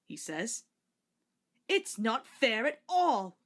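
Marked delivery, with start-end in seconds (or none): 1.7-3.4 slow, louder grumbling complaint. A narrator's reading. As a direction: drag it out like a sulky old coach. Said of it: "grumbles Henrietta".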